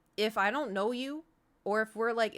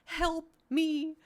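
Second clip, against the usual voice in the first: high, pleading cry for help; silent plea for help; 9 semitones higher, 4 dB louder than usual. Retold or imitated. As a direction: beg for rescue in a higher voice. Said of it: "I wanted to be like".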